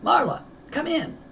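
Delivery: crackly high radio voice; over the radio. A narrator's reading. Sheriff calls the deputy over the radio, radio voice. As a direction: sound like it comes through a police radio. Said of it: "voice cackled through the radio".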